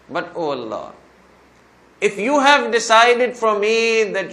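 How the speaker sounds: loud, high appeal to Allah, slowing on the condition; imitating a penitent pleading forcefully with God; retold fervent dua. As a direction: press each phrase out, loud and insistent. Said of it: "I made this dua to Allah".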